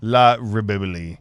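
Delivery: "La Ribibili", b sounds replacing the v's; joke-Spanish "La Ribibili", ending in "lee"; mock Spanish pronunciation. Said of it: "In Spanish, that's".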